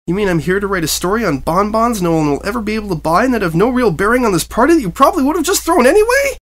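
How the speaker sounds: high, loud squeaking disbelief; reporter's voice cracking up high; squeaky. A narrator's reading. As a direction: squeak it out wide-eyed. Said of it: "Press Pass squeaked".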